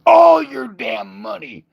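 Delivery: high, loud mock demand; hammed-up divine shout for cash; greedy god shout. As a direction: boom it out like a greedy deity.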